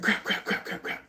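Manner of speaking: frantic whispered crap; whispered panic. Pretend. Sibling whispering in panic while running over. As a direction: hiss the crap words in a rush. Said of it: "they're like".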